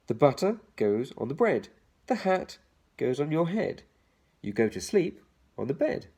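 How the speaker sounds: put-on bouncy children's rhyme read. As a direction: lilt each line to its rhyme. Reads the rhyme aloud.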